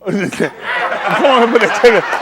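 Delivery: drawn-out words, very slow, laughing through them; laughing slow urging. Real, not acted. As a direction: stretch it out slowly while laughing. Host laughing, urging contestant.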